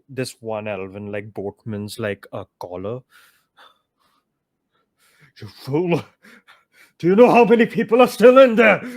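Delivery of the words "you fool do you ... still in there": high, loud, fast furious shout; furious yelling back. Acted. Chief grabbing a boatman's collar, yelling back in fury. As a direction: tear into him for fleeing. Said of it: "yelling back".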